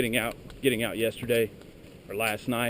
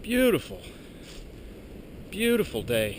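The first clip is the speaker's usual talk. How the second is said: excited; 5 semitones higher than usual. Real, not acted. Admiring the view on the ridge.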